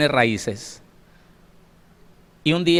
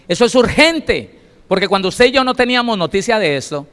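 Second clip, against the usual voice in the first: urgent preaching; 7 semitones higher, 6 dB louder than usual. Real, not acted. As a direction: preach it like an alarm to the congregation. (predicación urgente, lenta y muy fuerte)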